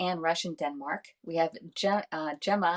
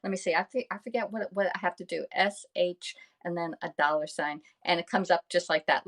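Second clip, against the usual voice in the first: ordinary talk, nothing marked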